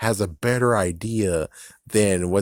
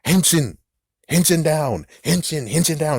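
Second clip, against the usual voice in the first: mock transformation call; 7 semitones higher, 4 dB louder than usual. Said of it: "they followed suit and be like".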